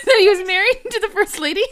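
high, loud, laughing question; incredulous, cracking up; laughing disbelief. Real, not acted. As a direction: ask it through rising laughter. Laughing at listener's question about story.

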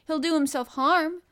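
high, loud pleading from a flustered nurse; hurried and urgent. A narrator's reading. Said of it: "she said in a great hurry".

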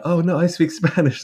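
high excited dog voice; mock eager dog. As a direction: pitch it up like an overeager dog. Voicing the dog.

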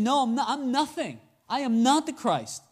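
insistent high-pitched denial on "not" and "nothing"; escalating denial. Acted. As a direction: push "I am not the Christ" up in pitch, emphatically. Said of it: "he is elevating".

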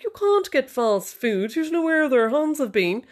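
put-on high, slow, haughty posh accent; mock posh husband. Husband saying no to wife about fast food, mock posh voice. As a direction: refuse in an exaggerated posh voice, drawing it out. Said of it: "I just think he's awfully posh".

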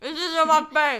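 loud, high mock proclamation; loud mock announcement. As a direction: announce it with a full mouth.

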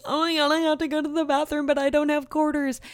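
high, frantic plea to the clerk; desperate pleading. Retold or imitated. Runner begging the laundromat clerk for the bathroom, frantic high plea. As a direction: beg like you're about to wet yourself. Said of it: "I was like".

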